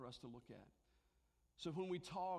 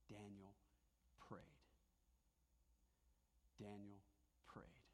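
soft emphasis; 8 semitones lower, 17 dB quieter, slower than usual. Real, not acted. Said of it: "write this on your".

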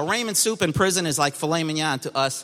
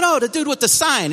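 surprised recognition; 9 semitones higher, 4 dB louder than usual. Retold or imitated. Recognizing the man from the sign.

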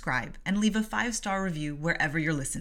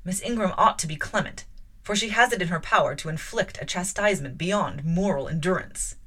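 measured, louder reading voice with lightly voiced characters; story narration; 6 dB louder than usual. A narrator's reading. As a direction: read the novel aloud to listeners. Reads novel aloud with voices.

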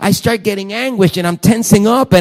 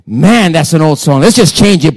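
wife's loud remark; 8 dB louder than usual. Retold or imitated. Wife dismissing song.

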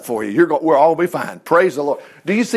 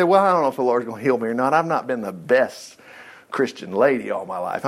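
doubtful woman voiced; 7 semitones lower, 4 dB quieter than usual. Preacher voicing Laura doubting she deserves healing, lower and softer. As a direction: voice her quiet worry about being unworthy.

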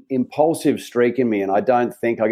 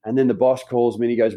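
ordinary delivery

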